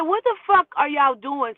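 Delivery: loud shocked yell at the roommates; retold yell. Confronting roommates over a knife, shouting. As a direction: yell it, stunned and angry.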